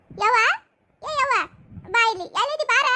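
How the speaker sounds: loud call across the house. Acted. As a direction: shout for her to come here. Calling mother-in-law from afar. (ದೂರದಿಂದ ಜೋರಾಗಿ ಕೂಗುವುದು)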